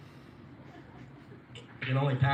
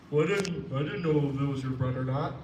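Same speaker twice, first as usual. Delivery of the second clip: Alan voice; 2 semitones higher, 5 dB louder than usual. Retold or imitated. Alan voiced in retold argument.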